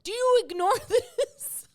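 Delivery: high, loud, slowed retort with a laugh breaking in; incredulous retort. Retold or imitated. Host retelling her answer to critics, in a high incredulous retort. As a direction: fire it back as if the question is absurd. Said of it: "I'm like".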